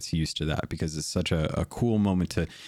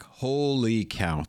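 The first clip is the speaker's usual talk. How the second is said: slow, higher-pitched awed exclamation; drawn-out amazement; 4 semitones higher, much slower than usual. Real, not acted. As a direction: stretch it out, really impressed. Amazed praise of the vendors.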